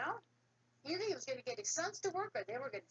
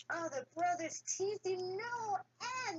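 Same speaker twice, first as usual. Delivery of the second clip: ordinary delivery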